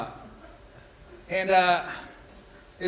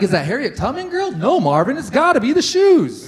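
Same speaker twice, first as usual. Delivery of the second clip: mock onlooker; 6 semitones higher, 7 dB louder than usual. Acted quote of onlookers.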